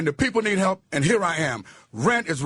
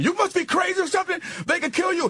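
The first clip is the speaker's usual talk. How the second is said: alarmed soldiers shouting; 13 semitones higher than usual. Retold or imitated. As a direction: yell it like a panicked comrade. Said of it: "they told me again".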